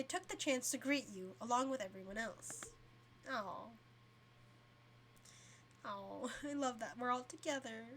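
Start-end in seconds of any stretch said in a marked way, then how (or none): none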